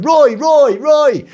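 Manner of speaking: high, loud calling out across a crowd; shouting a name. Retold or imitated. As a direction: yell Roy to grab the photographers. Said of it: "these people going".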